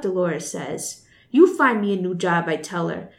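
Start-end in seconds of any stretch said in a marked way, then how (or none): 1.3-2.5 louder, defiant comeback; defiant retort. Acted. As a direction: throw it back at her. Frances snaps back at Dolores.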